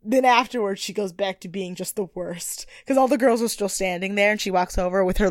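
ordinary delivery

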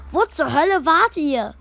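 reproachful. A narrator's reading. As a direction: demand where they were, cross. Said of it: "sagte Shade vorwurfsvoll".

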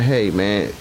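normal talking voice

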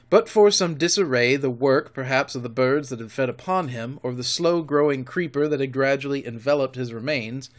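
ordinary delivery

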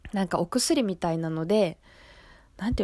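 usual speaking voice

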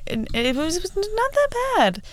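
high, emphatic disbelief; high-pitched protest; insisting. Real, not acted. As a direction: squeak it out, it really wasn't bad. Insists to viewers.

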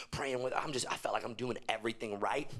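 ordinary talk

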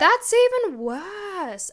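high, drawn-out exasperation; indignant. Real, not acted. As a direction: stretch it out high in protest.

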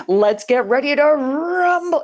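booming arena announcer, drawn out; monster truck announcer. Retold or imitated. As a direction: hype the crowd like a ring announcer. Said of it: "He is the monster truck guy".

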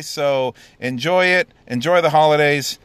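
big booming send-off; loud sign-off. Real, not acted. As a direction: belt out the goodbye.

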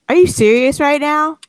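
can't believe what he's asking; incredulous. Retold or imitated. Confronting the scarf scammer.

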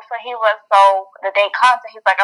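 ordinary talk

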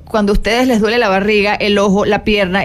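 ordinary delivery

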